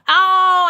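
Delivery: loud, high "ow" of sudden pain; pained yelp. Real, not acted. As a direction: yelp as if you banged yourself. Just hit the table.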